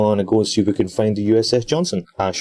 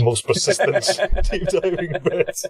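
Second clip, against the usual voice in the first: laughing; 9 semitones higher than usual. Pretend. Jokey title banter, laughing.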